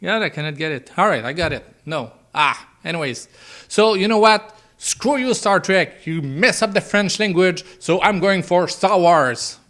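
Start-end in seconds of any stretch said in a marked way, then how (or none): 3.7-9.6 playful indignant rant, higher and louder; hammed-up fed-up fan; a touch of mock anger; mock outrage. Mock outrage at Star Trek.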